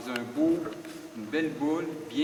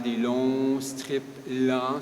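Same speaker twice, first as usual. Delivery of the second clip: slow, stretched-out words; drawn-out description; 4 dB louder, much slower than usual. Shown as a demonstration. Fishing instructor describing long slow strips, drawn out.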